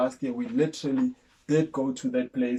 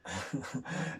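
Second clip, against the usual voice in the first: a quick real laugh; short laugh; 4 semitones lower, 9 dB quieter than usual. Real, not acted. Co-host laughing at the frustrating delay, a short real laugh. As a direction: laugh at the memory of chasing paperwork.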